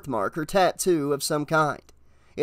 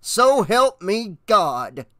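slow, loud angry oath; heavy, slowed warning, raised voice; growled threat; 5 semitones higher, 5 dB louder, much slower than usual. A narrator's reading. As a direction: drag out each word in fury. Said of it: "he growled".

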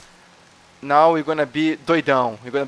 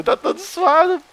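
much higher squeaky repeat of "tá todo suado"; mocking squeak; 4 dB louder than usual. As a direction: squeal "tá todo suado" up high to mock him.

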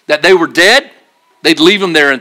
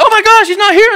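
excited women; 3 dB louder than usual. Retold or imitated.

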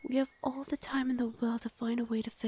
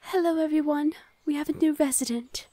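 louder, higher call to the whole village; cheerful announcement; 3 semitones higher, 7 dB louder than usual. Acted. Guardian introducing the newcomer to the villagers, cheerful call. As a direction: raise the voice to greet everyone.

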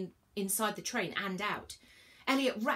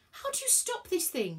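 high, urgent yell, not full volume; narrated panicky yell; 11 semitones higher than usual. A narrator's reading. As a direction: call out in a panic, pitched high. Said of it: "yelled Virgo".

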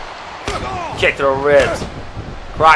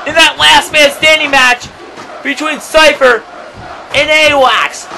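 raised, higher shouted recap; excited shout; 8 semitones higher than usual. Real, not acted. Commentator explaining the Summer Bash match, excited raised shout. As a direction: keep yelling the history lesson.

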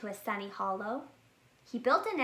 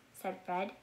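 ordinary delivery

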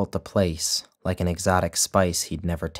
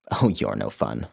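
lower, pouty fake disappointment; mock hurt; 7 semitones lower than usual. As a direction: pretend your feelings are hurt. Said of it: "mimicking hurt".

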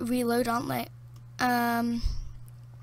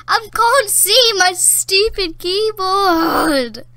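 loud, higher, very drawn-out complaint; exasperated wail; 4 semitones higher, 6 dB louder, much slower than usual. Real, not acted. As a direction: wail it slowly, stretching every word. Fed up playing in the dark.

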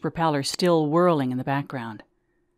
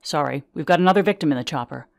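fast, brusque, no time to talk; brisk paramedic; 5 dB louder, faster than usual. Acted. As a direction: rattle it off. Paramedic hurrying off.

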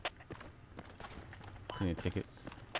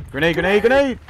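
rising panicked yell, louder each time; grenade warning shout; 11 semitones higher, 9 dB louder than usual. Real, not acted. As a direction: scream the warning to your squad.

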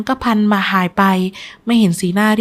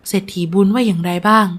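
ordinary delivery

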